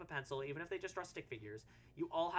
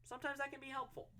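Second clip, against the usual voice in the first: normal talking voice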